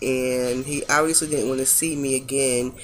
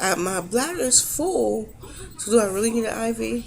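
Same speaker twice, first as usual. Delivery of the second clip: higher-pitched, mildly pleading objection; retold reluctant protest; 6 semitones higher than usual. Patient to doctor about the IV, retold reluctant protest. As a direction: argue lightly against it, voice raised in pitch.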